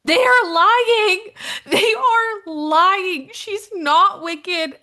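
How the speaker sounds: slow, high, loud protest; hammed-up indignant cry; mock outrage. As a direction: insist hard like an indignant fan. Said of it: "And Maya goes".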